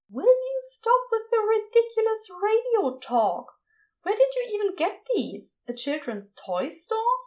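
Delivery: high fussy scolding; prim exasperated scold; Aziraphale voice. Acted.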